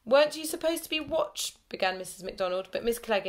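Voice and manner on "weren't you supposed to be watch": stern teacher. Acted. Teacher cut off mid-question.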